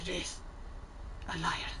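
put-on Scottish accent, fairly low-key. Streamer parroting a game character's introduction, put-on Scottish accent. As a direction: echo it in an amateur Scottish accent. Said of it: "the better my Scottish accent will get".

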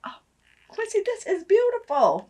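high gushing excitement; high, thrilled praise. Real, not acted. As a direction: gush over it. Gushing to Quincy.

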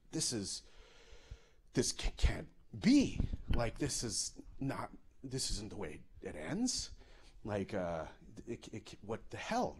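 quiet, halting inner voice; stunned disbelief. Acted. Passenger thinking he may die, hushed stunned disbelief. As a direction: think it aloud softly, faltering. Acting his stunned thoughts on plane.

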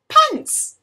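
high, delighted pants!; spring the word pants! up high; surprised. Acted. Reader reveals the penguin's pants to children, high and delighted.

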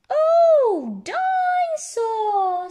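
high, drawn-out delighted cry; loud, high-pitched wonder; excited little George. A narrator's reading. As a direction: squeal it slowly like a thrilled little child. Toddler's delighted cry in story.

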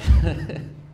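short loud burst of laughter; sudden self-amused laugh; laughing at own joke. Real, not acted. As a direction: crack up at your own joke. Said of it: "I laughed at myself".

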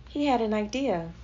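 ordinary delivery, nothing marked

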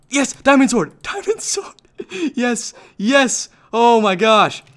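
high, excited, breaking into a laugh; jubilant. Real, not acted. Player crafting a diamond sword in time, jubilant. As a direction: exult over the sword you just made.

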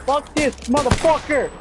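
high-pitched, heated shouting; angry gamer cursing. Real, not acted. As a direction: curse out the enemy mid-fight. Cursing an enemy mid-fight.

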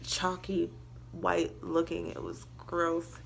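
muffled by cloth over mouth; speaking while wiping face; talking through a towel. Real, not acted. Seen 1: Face towel covers mouth and nose while she speaks; absent in references.

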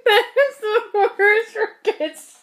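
talking through laughter; cracking up mid-sentence; laughing. Real, not acted. Friend reacts to skunk breath.

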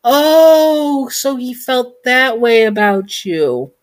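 high, drawn-out oh, oh, oh; stunned realisation. Acted. Girl realising Bunny Mun has feelings for her, climbing high oh oh oh. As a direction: let the surprise climb on each oh. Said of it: "Your eyes widen".

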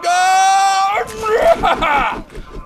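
big burst of laughter; loud laugh. Real, not acted. Laughs after dying.

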